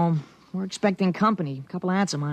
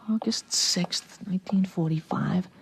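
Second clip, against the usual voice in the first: breathy whisper, slow on the date; 3 semitones lower, 4 dB quieter, slower than usual. Acted. Boy whispering the date to himself. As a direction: whisper it like a secret note to yourself.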